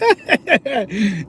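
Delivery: high-pitched acted chuckle; high staged snicker; sly mock laugh. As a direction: put on a high, gloating laugh. Acts out his own laugh.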